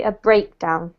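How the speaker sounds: A breakdown, BREAK stressed, down light. Shown as a demonstration. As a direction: model breakdown with the weight on BREAK. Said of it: "more on this one".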